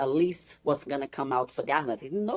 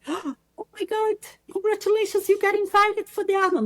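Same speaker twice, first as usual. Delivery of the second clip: put-on much higher, gushing girlfriend voice; excited congratulations; 3 dB louder than usual. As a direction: squeal the news to a friend. Bikini girls congratulating by text.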